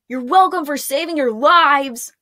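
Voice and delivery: a sarcastic parting yell; yelled after them. Acted. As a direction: fling it after them bitterly. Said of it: "Mavic yelled after them".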